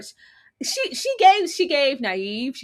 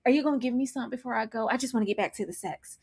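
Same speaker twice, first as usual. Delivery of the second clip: much softer, fast pleading; mock sleazy murmur; 2 semitones higher, 7 dB quieter, faster than usual. Mocking Rick's request.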